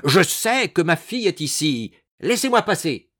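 high, forceful demand; bellowing. A narrator's reading. Madame demanding that guards let her pass, bellowing. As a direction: clamour to be let through. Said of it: "de toute la force de ses puissants poumons".

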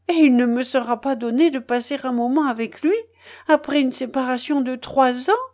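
pleading moan, a little fast; lightly voiced mother moaning. A narrator's reading. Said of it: "gémit".

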